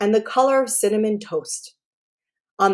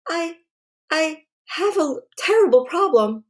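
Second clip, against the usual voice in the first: put-on girl's voice; 8 semitones higher than usual. A narrator's reading. Caitlin voiced, distressed.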